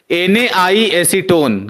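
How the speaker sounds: एन ए आई acetone spelled out slowly and loudly, letter by letter; slow loud drill. Shown as a demonstration. Teacher drilling the reagent name slowly, loud. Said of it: "क्या बोले?".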